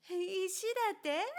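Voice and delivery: laughing out the question. Acted. Boy learning Kura means stone, laughing. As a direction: burst into real laughter. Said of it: "本当におかしくて笑った".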